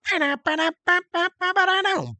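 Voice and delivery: very high vocalized horn riff; imitated riff. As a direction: blare the riff in ba-da syllables. Mimics the record's horn riff.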